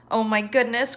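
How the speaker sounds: usual speaking voice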